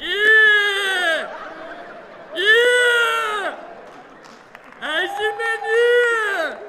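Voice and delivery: slow, booming high yell of ايه; loud shout. Acted. Loud man bellowing at the villagers, slow drawn shout. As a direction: bellow it, dragging the vowel. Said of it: "صوتك يعلى قوي".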